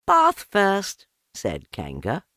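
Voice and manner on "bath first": bright high cheerful voice; cheerful mother with a plan. A narrator's reading. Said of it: "in a cheerful voice".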